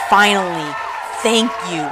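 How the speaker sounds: loud, relieved thanks; grateful outburst. Retold or imitated. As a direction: cheer it out like you finally got support. Clapping at desk, grateful reaction.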